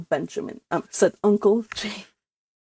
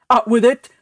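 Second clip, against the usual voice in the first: louder, impatient demand; a brusque uncle demanding the truth; gruff uncle; 4 semitones higher, 7 dB louder than usual. Acted. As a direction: bark it out impatiently.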